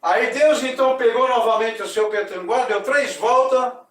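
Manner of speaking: loud, ceremonious storytelling voice; story narration. A narrator's reading. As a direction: proclaim the myth to the audience. (narração solene e alta)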